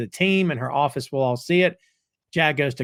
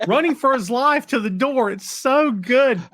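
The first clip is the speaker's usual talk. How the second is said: excited gush; 11 semitones higher, 4 dB louder than usual. Real, not acted. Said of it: "delighted retelling".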